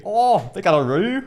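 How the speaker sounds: high, loud excited Aussie; Aussie Steve Irwin accent. Pretend. Friend ends the Irwin impression, loud excited Aussie. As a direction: marvel at a kangaroo like a TV host. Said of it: "do your best Aussie accent".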